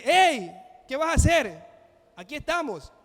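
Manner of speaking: llamada alta, más aguda y más lenta; grito imitado. Retold or imitated. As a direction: gritarle para detenerlo, alarmado. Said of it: "le grita y le dice". (Pablo le grita al carcelero desde la celda, alarmado)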